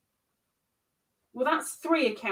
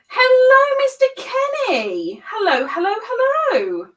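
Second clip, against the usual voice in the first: much higher, louder, sung-out hello; high sing-song greeting; 9 semitones higher, 6 dB louder than usual. Real, not acted. Crocheter greets viewer Mister Kenny, high sing-song. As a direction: greet him like a cheery welcome.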